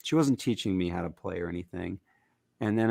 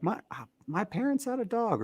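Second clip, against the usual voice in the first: lightly voiced stranger; 14 semitones higher than usual. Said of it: "people who are like".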